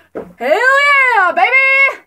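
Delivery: big high shout of joy; loud cheer. Shown as a demonstration. Showing how loud she is now, loud cheer. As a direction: yell it out like a hype fan. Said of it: "'Cause I'm so loud".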